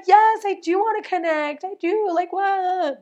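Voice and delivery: high-pitched indignant yes I do; indignant protest. Retold or imitated. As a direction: squeal it out, offended and defensive. Protesting to spirit, squeaky.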